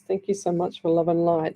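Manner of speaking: usual speaking voice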